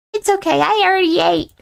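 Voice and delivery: high, dopey goofball; goofy character voice. Acted. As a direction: say it like a happy oaf. Pop declines clumsily.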